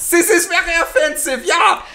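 high, hammed-up German accent. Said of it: "my English American German accent".

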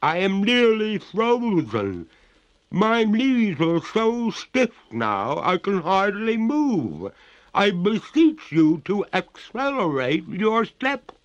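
very high, loud, slow ghostly wail; distressed water ghost. Acted. Freezing ghost begs master to hurry, high wailing. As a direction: plead in a drawn-out high voice.

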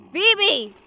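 loud call across the battlefield; urgent yell of a name. Acted. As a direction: shout for the missing friend. Calling a missing ally in battle.

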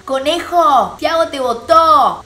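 loud, high, drawn-out yell; shouting from outside. Retold or imitated. Outsiders yelling news to the housemates, loud stretched shout. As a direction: holler it slowly across a distance. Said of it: "Gritaron desde afuera".

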